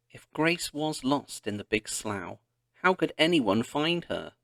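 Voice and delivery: ordinary delivery, nothing marked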